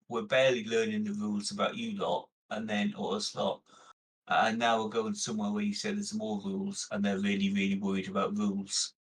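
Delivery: ordinary talk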